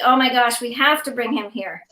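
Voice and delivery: usual speaking voice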